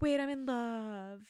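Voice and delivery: slow, drawn-out mock swoon; mock lovestruck. Retelling the heroine at the bar, slow mock swooning.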